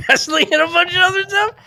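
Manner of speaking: quoting the barber through giggles; laughing disbelief. Retold or imitated. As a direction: crack up while asking it.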